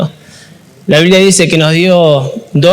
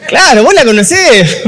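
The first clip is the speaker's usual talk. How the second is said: laughing; 6 semitones higher than usual. Real, not acted. Laughing about wife's voice.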